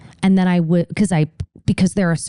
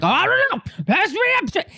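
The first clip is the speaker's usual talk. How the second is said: very high squeaky put-on voice; high mocking voice; 14 semitones higher, faster than usual. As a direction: squeak it out in a silly falsetto. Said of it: "He's like".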